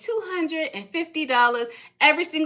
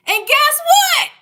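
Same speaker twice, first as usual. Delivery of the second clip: teasing excitement; 13 semitones higher, 6 dB louder, slower than usual. Real, not acted. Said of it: "teasing the audience".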